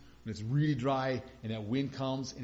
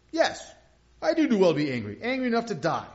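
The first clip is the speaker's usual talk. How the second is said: loud, high indignant retort; defiant Jonah; 6 semitones higher, 7 dB louder, faster than usual. Retold or imitated. Jonah hotly insisting to God he is right, loud and indignant.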